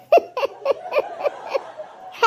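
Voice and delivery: breathy high giggle; cheeky little laugh. Acted. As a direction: snicker at someone else's scolding. Daughter giggles at the scolding.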